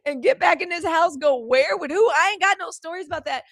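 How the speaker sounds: loud, high scolding mother through laughter; mama scolding her daughter, loud and high. Retold or imitated. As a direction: play the outraged Southern mama shutting it down. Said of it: "my mama would have been like".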